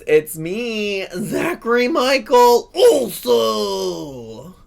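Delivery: high, loud, slow drawn-out welcome; sing-song greeting. Acted. Vlogger greeting viewers at the start, high and drawn-out. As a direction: croon the channel greeting like a show host.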